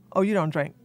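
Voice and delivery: ordinary talk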